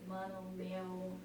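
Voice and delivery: a hint of São Paulo drawl on mano, meu; São Paulo slang. Shown as a demonstration. Showing friends how São Paulo people talk, a hint of slang. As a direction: say mano, meu like a laid-back Paulista. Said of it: "it's like this".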